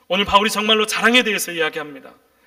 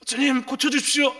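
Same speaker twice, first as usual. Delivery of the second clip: lightly voiced higher-pitched pleading prayer; imitated plea; 5 semitones higher than usual. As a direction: act out a plea to the Lord, pitched up. (높은 목소리로 간청하는 흉내)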